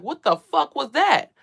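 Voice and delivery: high, loud, slow shocked cry; seeing a shadow figure run out of the bedroom, slow and alarmed; startled outburst. Retold or imitated. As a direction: stretch it out in shocked disbelief.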